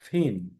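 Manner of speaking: thin said slowly with th; th sound demo. Shown as a demonstration. Teacher modelling thin. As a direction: stretch the th in thin. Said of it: "যেমন think".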